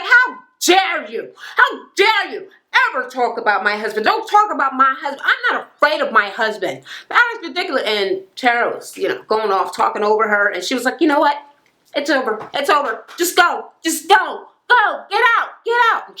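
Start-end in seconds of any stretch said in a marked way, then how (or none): 0.0-7.8 high-pitched furious mock outburst, pitch jumping way up; retold outrage. Brianna telling Tara off for insulting her husband, angry, high-pitched. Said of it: "Brianna got mad as hell". 11.0-16.1 retold throwing out. Brianna ordering Tara to leave, building to a high shout. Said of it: "Brianna got mad as hell".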